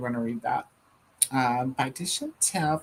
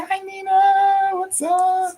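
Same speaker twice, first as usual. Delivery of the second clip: high, louder drawn-out hello; sing-song greeting; 6 dB louder, slower than usual. Real, not acted. Host greeting a viewer who just arrived, sing-song greeting.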